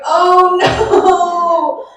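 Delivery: high, loud mock panic, half sung. Comic panic at the keynote invitation, high and loud. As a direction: fake alarm at a serious invite. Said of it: "And I'm like".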